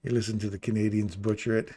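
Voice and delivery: ordinary talk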